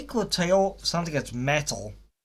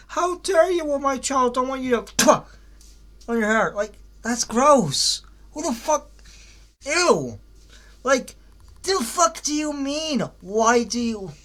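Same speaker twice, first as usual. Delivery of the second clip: high, indignant mock parent outrage, mostly hammed-up; 8 semitones higher than usual. Reviewer acting out disgust at the cartoon parents, mock outraged and high. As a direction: play the appalled parent scolding, half for laughs.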